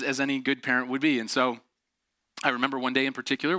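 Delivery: usual speaking voice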